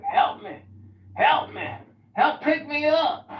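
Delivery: high, loud pleading cries of help; old man calling for help. Retold or imitated. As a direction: beg a passer-by to pick you up. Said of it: "He's like".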